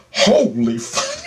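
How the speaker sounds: slow, high holy fuck; stunned. Retold or imitated. Watching the aliens scatter, slow stunned disbelief. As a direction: stretch it out in shock.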